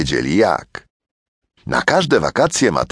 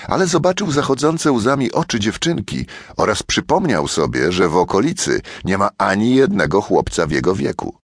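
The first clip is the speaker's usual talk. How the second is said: plain reading voice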